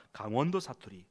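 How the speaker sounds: normal talking voice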